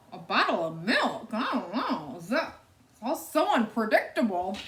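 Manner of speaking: a bemused husband, scratching his head; Mister Watson's voice. Acted. Mister Watson, puzzled about the piglet, mild bemused mumble.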